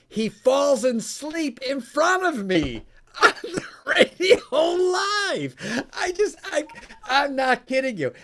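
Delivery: high, loud, laughing disbelief; incredulous excitement. Real, not acted. Host recounting a guest dozing off live, incredulous and loud. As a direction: voice climbing to an amused shout.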